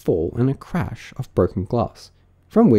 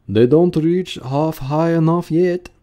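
slow, higher-pitched rustic voice; complaining workman; 7 semitones higher, 4 dB louder, slower than usual. Acted. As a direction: report it slowly to your mates. Another workman reports on the ladders.

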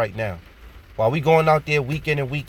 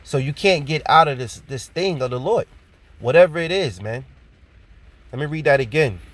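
normal talking voice

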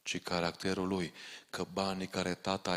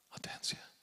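whispered, much softer caution; whispered warning; 10 dB quieter than usual. Real, not acted. Preacher cautioning parents in the hall, whispered warning. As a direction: drop to a whisper to warn them.

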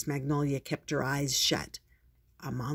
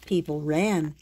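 plain reading voice

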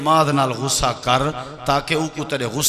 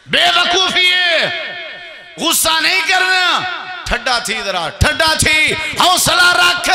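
preacher's shout; 4 dB louder than usual. Acted. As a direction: call out over the crowd to cool down. Preacher admonishes against anger.

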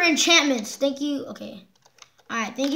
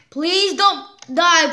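slow plea; 3 semitones higher, 4 dB louder, much slower than usual. Real, not acted. Pleading in tense fight.

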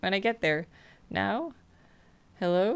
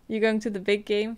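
ordinary delivery

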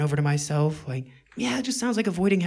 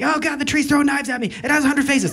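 higher, louder alarm; panicked; 8 semitones higher, 6 dB louder than usual. Acted. Meditator panicking at a knife-throwing tree. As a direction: panic as the calm image goes wrong. Meditation image turns scary.

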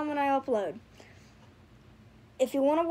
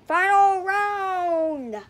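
slow, loud arena announcer; booming fighting game announcer; 2 semitones higher, 5 dB louder, much slower than usual. Acted. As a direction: draw out the call like a fight announcer. Announcing the plushie battle.

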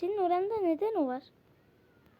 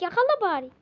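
ordinary talk, nothing marked

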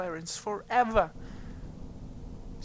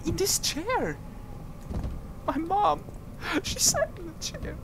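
giggly high; 13 semitones higher than usual. Pretend. Joking, laughing.

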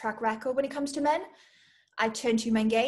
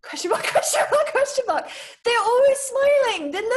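high, loud, gleeful gush; giddy excitement; 13 semitones higher, 8 dB louder than usual. Acted. Applicant gushing over her favourite animal, high giddy excitement. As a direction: squeal it out with delight. Giddy outburst during quick-fire answers.

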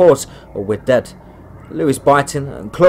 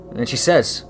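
usual speaking voice

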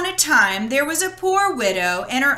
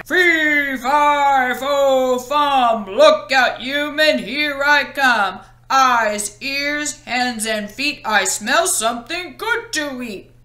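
booming, louder giant chant; shouting giant; 3 dB louder, slower than usual. Acted. Giant announces himself while sniffing for Jack, booming chant. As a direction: stomp in as a hungry giant. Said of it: "The giant came in shouting".

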